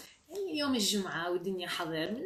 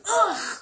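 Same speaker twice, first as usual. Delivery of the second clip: high, loud "ouch"; sharp acted pain cry; 11 semitones higher, 12 dB louder, much slower than usual. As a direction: yelp as if hit on the head. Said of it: "صرخ العم نظيف من شدة الألم".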